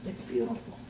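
whispered. Acted. Preacher repeating to the church, now in a whisper. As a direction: breathe it as a hushed whisper.

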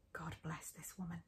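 breathy hushed whisper of gratitude; whispered aside. A narrator's reading. Narrator whispering her gratitude for her friend, hushed. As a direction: whisper it like a heartfelt thank-you. Grateful aside about Maya.

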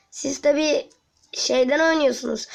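ordinary delivery

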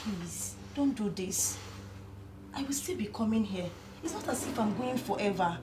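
hushed, begging whispers; a soft, broken please; soft pleading. Acted. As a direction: whisper please to calm him. Pleading softly with lover.

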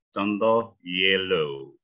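yellow drawn out slowly with a clear Y sound at the start; slow pronunciation demo. Shown as a demonstration. Coach showing students the Y in yellow, slowly. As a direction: model yellow slowly for the class. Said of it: "jadi bunyi konsonan".

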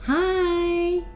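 ordinary delivery

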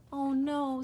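high, loud dismayed cry; dismayed gasp. Acted. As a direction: say it like the bad news just hit. Dismay at bad news.

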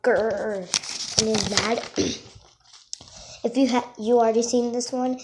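ordinary delivery

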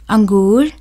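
normal talking voice

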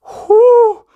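breath out that cracks way up high and loud; a real, sudden squeaky crack on the exhale. Real, not acted. Gamer's voice cracks on camera while recapping playoffs. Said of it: "that voice crack was crazy".

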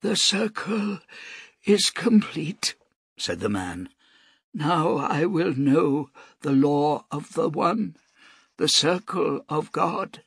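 high, slow, frail priest voice; a dying old man, slow and reverent. A narrator's reading.

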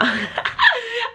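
high, bright burst of laughter. Real, not acted. Laughing at her own toxic trait.

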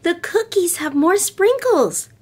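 put-on high, delighted child's voice; little girl Lisa. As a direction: sound like a pleased little girl.

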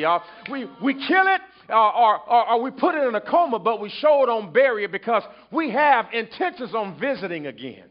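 fervent sermon cadence, loud and high; preaching. Acted. Preacher driving the point home to the church, fervent. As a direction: preach it to the congregation.

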